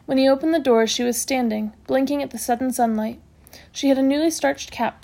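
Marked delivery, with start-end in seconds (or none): none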